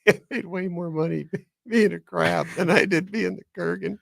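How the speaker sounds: high-pitched, giggly pretend bragging; laughing mock boast. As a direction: crack up while playing the actor. Joking as the actor, laughing.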